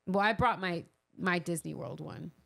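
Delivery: normal talking voice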